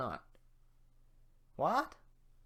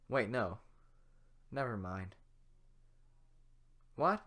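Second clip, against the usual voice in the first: ordinary delivery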